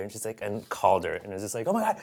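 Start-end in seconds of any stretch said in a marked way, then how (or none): 1.7-2.0 higher excited imitation; mock excited. Fiancée phoning her best friend, mock excited. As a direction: gush the news.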